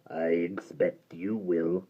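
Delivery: deep gravelly doom; menacing creature pronouncing fate; low, slow creature verdict. Acted. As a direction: sentence them slowly and low. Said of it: "replied the Mudlik".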